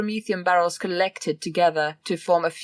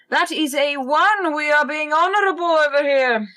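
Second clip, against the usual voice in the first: excited; 7 semitones higher than usual. Real, not acted. Rolling dice, proud of honor.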